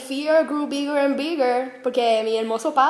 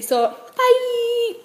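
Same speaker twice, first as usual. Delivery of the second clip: so, bye squeaked way up high; signature bye; 10 semitones higher than usual. Real, not acted. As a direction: her trademark high sign-off. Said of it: "ese bye ... es mío".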